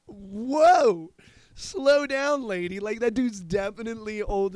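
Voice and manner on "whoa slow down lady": loud laughing whoa, much higher; laughing shout. Retold or imitated. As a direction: laugh and yell at her to slow down. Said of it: "And I'm like".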